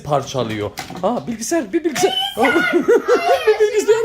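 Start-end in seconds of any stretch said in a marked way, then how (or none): 2.2-3.4 shrieking giggle, high and loud; high delighted laugh. Real, not acted. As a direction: burst into a squealing laugh. Delighted at pet's antics.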